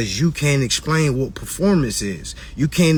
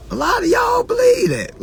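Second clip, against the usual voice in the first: shout. Real, not acted. Host shouting at viewers who think they're done. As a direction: shout it at them. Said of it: "repeated line".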